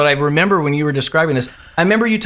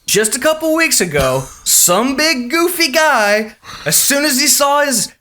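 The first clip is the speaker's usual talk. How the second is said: higher, drawn-out crowd-warmer spiel; warm-up comic; 8 semitones higher, slower than usual. Retold or imitated. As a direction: spin a cautionary tale for the audience. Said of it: "He acted it out".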